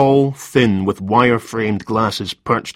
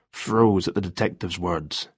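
ordinary delivery, nothing marked

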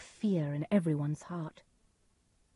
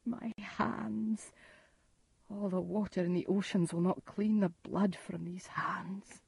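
soft, breath-heavy despair; hushed horror; 6 dB quieter than usual. Acted. Macbeth sees his bloody hands, hushed horror. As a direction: stare at the damage, appalled and quiet.

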